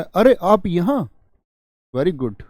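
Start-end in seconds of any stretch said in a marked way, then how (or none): 0.0-1.1 high, slow surprised exclamation; surprise. Shown as a demonstration. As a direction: sound truly surprised to see them. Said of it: "आश्चर्य". (ऊँचे स्वर में धीमा आश्चर्य)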